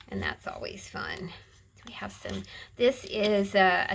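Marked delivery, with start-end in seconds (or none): 0.1-2.4 whisper. Real, not acted. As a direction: drop to a private whisper. Trailing aside while flipping pages.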